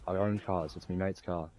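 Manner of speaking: normal talking voice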